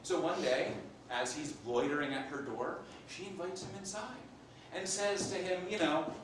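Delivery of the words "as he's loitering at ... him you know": ordinary delivery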